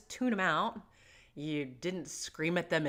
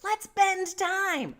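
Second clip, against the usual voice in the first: over-the-top playful agreement; hammed-up enthusiasm; 6 semitones higher, 5 dB louder than usual. Shown as a demonstration. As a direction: really get into it with the kid. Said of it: "maybe really get into it".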